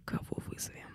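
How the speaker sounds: тихий шёпот; шепчет, будто страшно. Real, not acted. Рассказ про вызов духа. (шёпотом)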